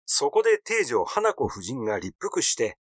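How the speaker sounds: usual speaking voice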